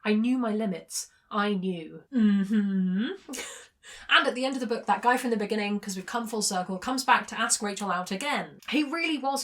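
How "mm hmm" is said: long, rising doubtful mm-hmm; sceptical hum. Real, not acted. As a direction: hum it as if unconvinced. Doubts the narrator's self-assurance.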